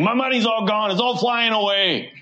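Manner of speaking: high-pitched mock lament over lost money; negative lament. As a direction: wail it lightly, high, as if all is lost. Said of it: "as a very negative thing".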